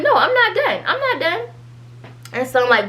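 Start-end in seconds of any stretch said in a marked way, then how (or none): 0.0-1.4 much higher-pitched, half-laughing retort; mock protest. Retelling a comeback to a friend's "okay", high-pitched mock protest. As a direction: push it way up high, playfully refusing to stop. Acted comeback in retold text argument.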